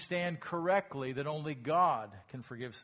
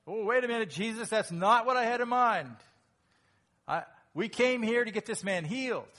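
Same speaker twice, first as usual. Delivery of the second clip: higher, louder startled objection; protesting friends; 8 semitones higher, 9 dB louder than usual. Acted. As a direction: object like a friend caught off guard. Friends voiced protesting to Jesus.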